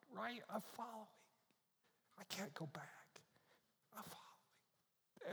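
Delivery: soft breathy whisper, barely voiced; a girl whispering her resolve at the cave. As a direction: hush it as if the goblins might hear.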